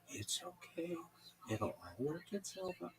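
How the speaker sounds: soft, quiet reassurance; calmest voice. Retold or imitated. Radio host calming the caller, quietly soothing. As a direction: settle it gently. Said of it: "Just the calmest way".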